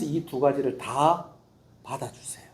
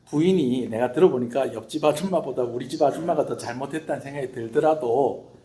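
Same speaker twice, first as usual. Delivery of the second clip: normal talking voice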